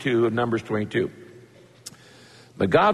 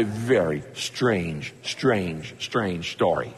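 each strange drops lower and softer; fading to quiet; 10 semitones lower, 5 dB quieter, much slower than usual. Real, not acted. Pastor marvelling to congregation, fading lower and softer. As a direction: let the repeated strange sink away. Preacher fades on repeated strange.

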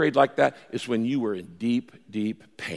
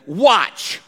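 WATCH higher and punched; punchline emphasis; 5 semitones higher, 4 dB louder than usual. Acted. Kid quoting a verse to get his watch, watch punched. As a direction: hit the word watch as the payoff.

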